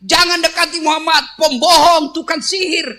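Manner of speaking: high, loud repeated warnings; shouting blind man. Acted. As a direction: yell at the passers-by. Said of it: "Yahudi yang teriak-teriak".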